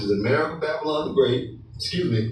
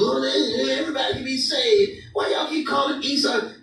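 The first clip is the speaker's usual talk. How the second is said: mocking high voice; 9 semitones higher than usual. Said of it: "A lot of you trying to save".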